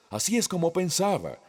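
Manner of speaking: normal talking voice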